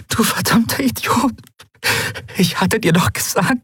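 tearful scolding. Acted. Endriel scolding dying Miko, crying.

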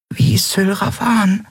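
ordinary delivery, nothing marked

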